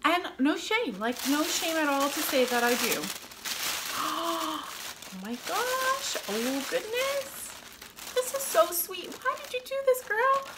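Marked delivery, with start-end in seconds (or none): 3.1-5.1 sharp delighted gasp; surprised gasp. Real, not acted. Opening a sweet gift. 5.2-10.5 high touched gushing to a friend; delighted surprise. Real, not acted. Opening a sweet gift.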